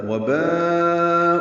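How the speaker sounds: وباء with an extended madd muttasil; long madd. Shown as a demonstration. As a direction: hold the alif in وباء very long. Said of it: "چار حرکات سے زیادہ لمبا کرتے ہیں".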